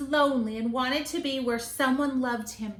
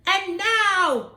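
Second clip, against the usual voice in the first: loud and higher, calling out; cried out; 8 semitones higher, 7 dB louder than usual. Acted. Max starting the rumpus, cries out. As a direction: announce it to the monsters. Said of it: "cried Max".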